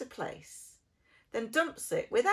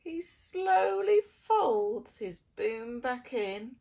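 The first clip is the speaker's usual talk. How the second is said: usual speaking voice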